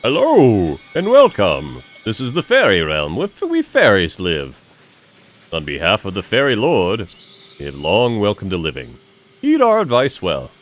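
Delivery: fairy voice. Acted. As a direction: welcome the traveller like a grand fairy herald. Voices the fairies' greeting.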